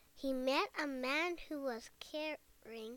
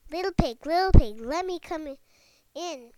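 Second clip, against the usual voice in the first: loud wolf call, pitched up; 3 semitones higher, 15 dB louder than usual. A narrator's reading. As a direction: bellow it at the door like a wolf. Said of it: "cried the wolf".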